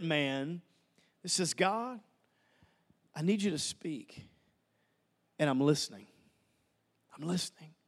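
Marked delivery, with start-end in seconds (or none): 1.5-7.7 low, soft, hushed plea to God; quiet prayer. Acted. A believer asks God to speak, in a low hushed prayer. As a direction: pray it, barely above a breath.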